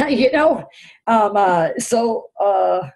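high, loud laughed-out "you know"; nervous laughing. Real, not acted. Speaker joking about losing her mind, laughing.

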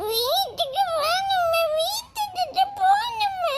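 slow, high, sobbing wail. Acted. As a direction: cry it out in despair. Woman wails over missing child. (அழுகையுடன் புலம்பல்)

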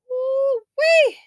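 shrill-free high whoop of disbelief; high woo-wee. Real, not acted. Reacting to the loss.

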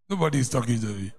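usual speaking voice

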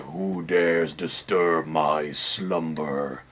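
low, slow, drawn-out monster voice; awakened statue. Pretend. Statue woken from slumber, challenging the intruder, low and slow. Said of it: "stand up and be like".

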